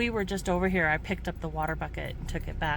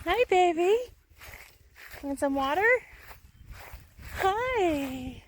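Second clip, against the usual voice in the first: cooing to a scared pet; 11 semitones higher, 3 dB louder than usual. Real, not acted. Greeting a stray sheep.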